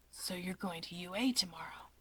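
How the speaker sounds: soft unvoiced whisper, curious; whispery Shigaraki. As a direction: breathe the question quietly. Shigaraki perks up.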